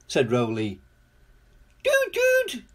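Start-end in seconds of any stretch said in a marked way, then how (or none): 1.8-2.6 very high, piping toots; whistle-high little bird call; bird chirp. A narrator's reading. Bird bobbing on Roly's cab, high chirping. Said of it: "chirped Bird".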